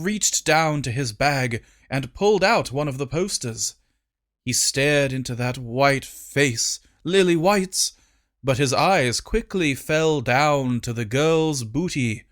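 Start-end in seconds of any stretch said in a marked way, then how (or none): none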